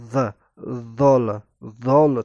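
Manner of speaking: "زال" with a voiced th, tongue between the teeth; interdental DH. Shown as a demonstration. Teacher demonstrating the Arabic letter, interdental "زال". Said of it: "زبان بین دو دندان".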